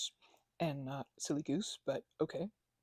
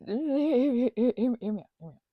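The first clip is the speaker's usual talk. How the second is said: excited hear me out; 6 semitones higher, 13 dB louder, faster than usual. Real, not acted. Excitedly demanding attention.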